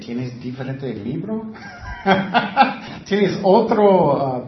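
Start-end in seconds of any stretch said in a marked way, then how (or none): 1.6-3.0 brief amused chuckle; short scoffing laugh. Real, not acted. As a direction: laugh at the absurd excuse. Scoffs at excuse of different book. (risa breve y burlona)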